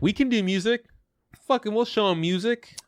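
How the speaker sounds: high, brash mock boast; mock cocky studio. Podcaster mocking the studio's boast, brash high voice. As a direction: puff up like a bragging studio exec.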